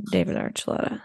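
under the breath, unvoiced name; whispered aside. Whispered aside correcting Lauren's mix-up.

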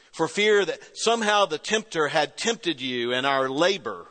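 ordinary talk, nothing marked

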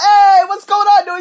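loud, high cheerful greeting; calling out. Retold or imitated. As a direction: shout hello across the room. Calling out to friend.

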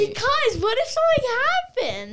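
high, loud protest; loud, high-pitched what-if; worried protest. Real, not acted. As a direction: cry it out as an alarmed objection. Child protests going to beach.

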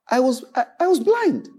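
high-pitched, halting reenacted confession; stammer, then jump high on the admission. Preacher voicing the healed man questioned about his eyes, high stammer.

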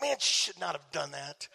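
low, subdued grumble; frustrated. Retold or imitated. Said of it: "I'm frustrated. I'm like".

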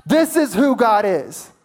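loud, high, driving sermon delivery; preaching at full force. Real, not acted. Preacher exhorting his congregation, full-force preaching. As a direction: build to a fervent climax.

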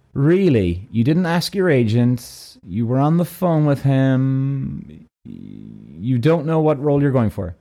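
slow, sceptical interrogator; suspicious agent, long drawn-out words; Secret Service type. Retold or imitated. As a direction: draw out each accusation in disbelief. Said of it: "like, the fucking Secret Service F B I".

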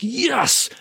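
a sudden high, delighted yes; excited yes. Retold or imitated. Host recalls seeing a listener count, a sudden high excited yes. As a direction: burst out with joy at the news. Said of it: "I'm like".

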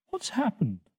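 high, small, bewildered question; a youngster just woken, lightly voiced; dazed little Toto. A narrator's reading. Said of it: "looking very dazed".

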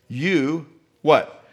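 usual speaking voice

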